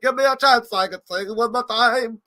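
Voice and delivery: put-on high, slow drunken plea; drunk begging. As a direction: plead like a drunk at karaoke. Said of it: "drunk guy getting thrown out of a karaoke bar".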